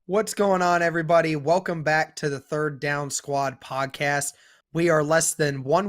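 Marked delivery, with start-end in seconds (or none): none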